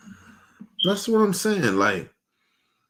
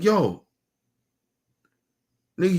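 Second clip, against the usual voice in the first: ordinary delivery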